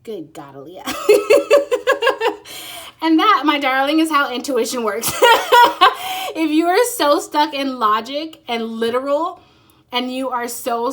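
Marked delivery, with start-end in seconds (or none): 0.9-3.0 delighted laugh. Real, not acted. Delighted at reading confirmed. 5.2-6.3 gleeful laugh. Real, not acted. Laughing at own insight.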